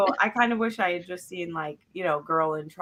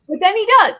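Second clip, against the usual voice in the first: high, loud exclamation; bursting out with a big high yelp; excited burst; 11 dB louder, faster than usual. Real, not acted. Sudden emphatic exclamation.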